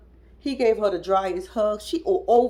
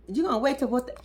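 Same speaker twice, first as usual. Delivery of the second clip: ordinary delivery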